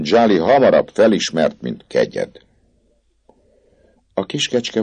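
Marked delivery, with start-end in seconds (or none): 0.0-2.4 reproachful. Acted. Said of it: "szemreányó hangon".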